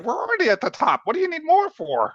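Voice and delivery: very high, fast squeak; squeaky rat voice. Acted. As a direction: chirp it like a rat. Player voicing character, high pitched.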